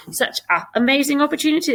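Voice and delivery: a high, fast repeat of "Such a amazing opportunity"; high, fast puzzled echo; quizzical repeat. Shown as a demonstration. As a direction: bounce "Such a amazing" back high and quick as if it sounds odd. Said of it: "It should be such an amazing opportunity".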